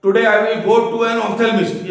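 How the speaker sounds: slow, emphatic model sentence with a clear "ophthalmist"; example sentence. Shown as a demonstration. Teacher modelling a sentence for students, slow and clear. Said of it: "एक example है".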